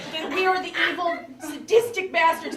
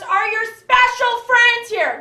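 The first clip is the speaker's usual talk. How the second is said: loud, high, slow loaded question; mother's pointed question; suspicious mom; 6 semitones higher, 7 dB louder, slower than usual. Retold or imitated. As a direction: lean on it with suspicion. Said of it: "And she goes".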